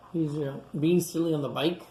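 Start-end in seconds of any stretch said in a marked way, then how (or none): none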